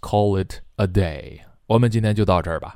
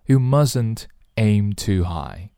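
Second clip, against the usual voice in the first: ordinary talk, nothing marked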